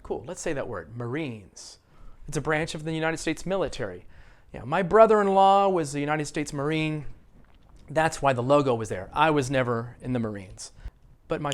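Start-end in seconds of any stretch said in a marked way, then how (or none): none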